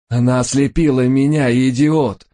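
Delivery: high-pitched, outraged wail; wailing yell. A narrator's reading. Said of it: "завопил Рой". (истошно вопит)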